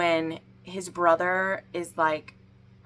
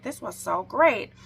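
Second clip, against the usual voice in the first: drawn-out, higher, louder fake rave; mock gushing; 4 semitones higher, 4 dB louder than usual. Reviewer unable in good conscience to praise the book, mock gushing. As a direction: put on insincere praise, slowly. Feigned praise she can't honestly give.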